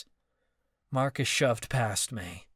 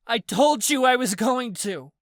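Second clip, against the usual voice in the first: much higher, louder retort; exasperated shout; 12 semitones higher, 7 dB louder than usual. Acted. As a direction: throw it back at him. Callie shouting back.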